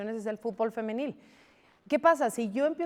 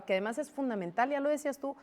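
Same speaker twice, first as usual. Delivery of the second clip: ordinary talk, nothing marked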